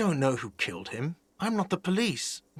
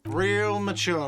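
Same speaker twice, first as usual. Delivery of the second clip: sarcastic; 5 semitones higher, 4 dB louder, slower than usual. Acted. Sarcastic retort.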